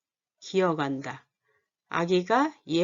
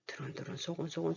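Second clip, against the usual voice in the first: soft whisper; 12 dB quieter than usual. Shown as a demonstration. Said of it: "감각적인 오감".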